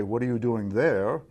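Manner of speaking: "there" pushed up at the end as the key word; stress on THERE. Shown as a demonstration. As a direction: put the weight on the final "there". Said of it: "it's a question about place and position".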